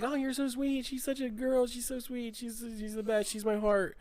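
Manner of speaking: high-pitched, sugary dad cooing; mock doting parent, high voice; gushing over daughter. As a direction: fawn over a little girl up high. Dad fawning over his daughter.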